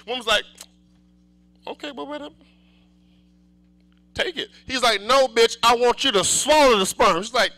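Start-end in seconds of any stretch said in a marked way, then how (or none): 1.7-4.5 reluctant woman. Retold or imitated. 5.0-7.2 loud demand. Retold or imitated. Robber insisting.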